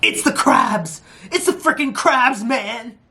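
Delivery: high-pitched mock alarm; contestant's outburst. Imitating a contestant who came up to another team, high mock outburst. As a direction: imitate an over-the-top frantic contestant. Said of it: "one person came up to another team and was like".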